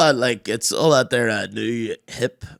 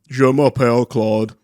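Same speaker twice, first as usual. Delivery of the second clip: mock schoolboy French; 2 semitones lower, 4 dB louder than usual. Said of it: "It's always Claude".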